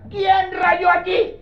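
put-on high, drawn-out shout at the child; acted parental outburst; angry parent demanding. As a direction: call out like an accusing parent. Parent confronting child over scribbles.

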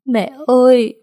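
high, loud, breaking cry; sobbing cry for mother. A narrator's reading. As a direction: cry out for her mother through sobs. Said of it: "vừa khóc vừa nấc lên".

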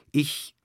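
"Ich" set alone, pitched high; emphatic. A narrator's reading. The count confessing to the lady, "Ich" said with emphasis. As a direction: lean hard on "Ich" and let it stand. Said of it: "sprach Miossin mit Nachdruck".

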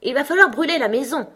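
high, loud rabbit announcement; rabbit's voice. A narrator's reading. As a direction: declare it loudly as the rabbit in charge. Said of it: "dit la voix du lapin". (voix de lapin, aiguë et forte)

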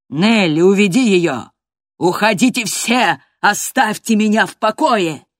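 loud, rising desperate orders; exploding shout. A narrator's reading. Master sends Nelly and everyone away, shouting.

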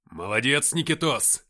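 loud, hearty exclamation. A narrator's reading. Captain cheering on his comrade Nikita in battle, exclaiming. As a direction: call out praise loudly. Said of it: "воскликнул я".

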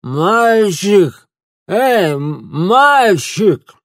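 put-on loud, slurred drunken calling out; drunk slurring. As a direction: shout after the boy with a slurring drunk tongue. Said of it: "позвал заплетающимся языком".

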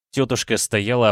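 ordinary delivery, nothing marked

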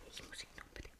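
hushed, breathless whisper; urgent whisper. Acted. A whisper to Gutman before he's cut off. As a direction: whisper it so no one overhears.

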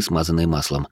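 ordinary delivery, nothing marked

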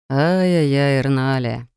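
a touch of stern rector; theatrical tutting. A narrator's reading. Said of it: "театрально покачал головой".

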